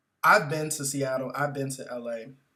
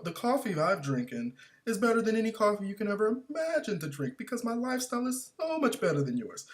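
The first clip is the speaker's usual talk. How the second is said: snobby air; 8 semitones higher than usual. Retold or imitated. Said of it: "talk with this air of sophistication".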